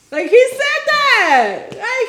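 high, slow incredulous stress on he; disbelieving that he really said it. Real, not acted. Friends gossiping, incredulous emphasis that he said it. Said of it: "outraged emphasis on quote".

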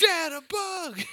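put-on high, loud, slow kid shout; toddler shout. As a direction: shout it like a thrilled two-year-old. Toddler voiced again.

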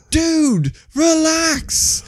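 high-pitched comic exasperation; startled protest. Retold or imitated. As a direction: plead with a panicking friend. Acted calming of panicking friend.

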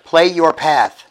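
slow, loud command to a smart speaker. Shown as a demonstration. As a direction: call out clearly to the device. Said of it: "You say".

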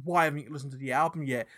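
usual speaking voice

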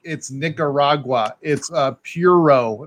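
slow, flat Anglo Nicaragua; gringo pronunciation. Retold or imitated. Cigar host mocking his own gringo pronunciation.

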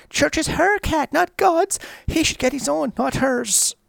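high, loud mock-whiny kid voice; whiny child. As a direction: whine it like a spoiled little girl. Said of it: "Ellie then whines".

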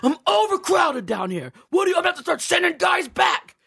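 high, shouted devil complaint; devil fed up. Acted. Devil threatening to send souls back, exasperated shout. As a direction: holler as an overwhelmed devil, drawing it out. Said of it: "He's like".

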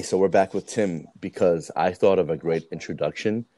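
ordinary talk, nothing marked

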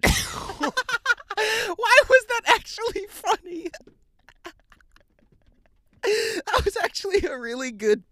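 high, slow, helpless laughing speech; real laughter. Real, not acted. Reader amazed the joke is funny, laughing. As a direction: squeal it through real laughter. Laughing after reading the punchline.

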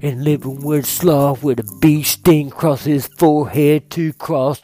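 loud, slow, spaced-out ramble; stoned rambling. Pretend. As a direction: ramble loudly like you're stoned out of your mind. Narrator now high, rambling.

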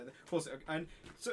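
ordinary delivery

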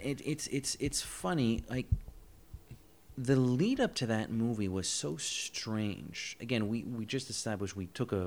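ordinary talk

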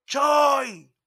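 grito agudo y fuerte, imitado; llamado a gritos. Retold or imitated. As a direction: imita a alguien que llama desde un auto. Imita llamada desde la camioneta. (llamado a voz en cuello, en español)

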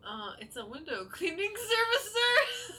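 fake polite service voice. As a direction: sell the lie as a chirpy window cleaner. Bluffing the bum as cleaner.

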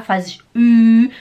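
"Ü" loud, lips rounded tight; Ü com lábios fechados. Shown as a demonstration. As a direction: push out a round, clear "Ü". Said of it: "Tem que fechar os lábios bonitinho". ("Ü" forte, com os lábios arredondados)

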